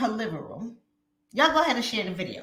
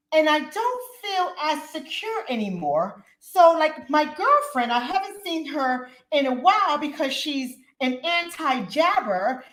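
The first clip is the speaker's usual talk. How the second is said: put-on higher, slow, fretful older woman voice; imitating mother-in-law; 6 semitones higher than usual. Vlogger acting out her worried mother-in-law: raised, slow fretful voice. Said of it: "my mother-in-law".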